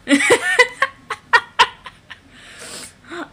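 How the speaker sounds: high, loud cackling laugh; shrieking laugh. Real, not acted. Huge laugh burst.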